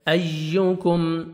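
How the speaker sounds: ایوکم very slow, the یا held and doubled; slow tajweed drill. Shown as a demonstration. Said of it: "یا کا sound آئے گا، یا مشدد ہے".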